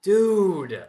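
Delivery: loud, high, falling cry of frustration; exasperated cry. Real, not acted. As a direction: draw the word out in loud exasperation. Despairs over failed run.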